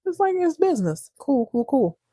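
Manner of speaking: high, mock-agreeable Richard; sarcastic Richard. Richard to Pam, fake calm, high and sarcastic. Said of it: "he's like".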